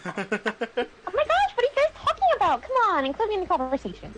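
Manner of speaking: put-on high, fast Valley Girl complaint; Valley Girl begging the group to include her, high and fast; Valley Girl whine. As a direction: whine to be let into the talk. Valley Girl left out of chat.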